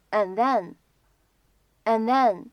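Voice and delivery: "and then" with the d held back, unreleased; incomplete plosion. Shown as a demonstration. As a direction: run "and then" together without releasing the d. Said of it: "不完全爆破的处理". ("and then"中的d不完全爆破)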